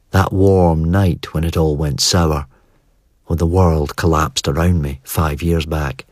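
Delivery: plain reading voice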